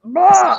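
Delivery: loud noise of revulsion; exaggerated yuck sound; disgusted "blah". Real, not acted. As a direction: blurt out a grossed-out "blah". Disgust at sticky things.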